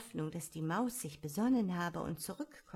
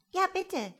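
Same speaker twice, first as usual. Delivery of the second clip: very high, louder plea; imitated chorus; 12 semitones higher, 5 dB louder than usual. A narrator's reading. The others echo Alice's plea, high imitated chorus. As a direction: chime in high like a little crowd. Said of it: "die andern wiederholten im Chor".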